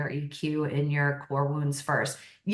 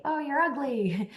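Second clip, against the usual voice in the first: put-on higher, light child's voice; little boy voice; 9 semitones higher than usual. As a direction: voice a blunt three-year-old. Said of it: "if a little boy said to you".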